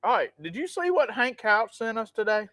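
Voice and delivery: ordinary talk, nothing marked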